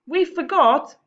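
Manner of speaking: put-on high, loud kids' apology; sheepish boys. Boys apologising to Mom, high guilty kid voices. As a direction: squeak out a guilty sorry. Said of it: "said the boys".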